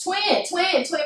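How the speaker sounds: mock calling out. Relatives excitedly calling the twins.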